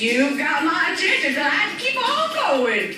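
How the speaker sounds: loud, high, put-on ad voice; hammed-up radio ad line, loud and high; ad character voice. Ad character calls out, loud and high.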